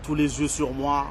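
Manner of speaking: slow, soft closing plug, fading away; promo sign-off. Acted. Rapper signing off his album promo, soft and slow. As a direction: wind the promo down gently.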